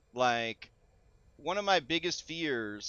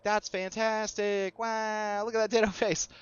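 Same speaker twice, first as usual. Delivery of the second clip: delighted awe; 6 semitones higher than usual. Retold or imitated. Retold first delighted reaction to animation.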